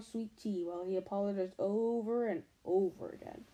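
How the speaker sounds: ordinary delivery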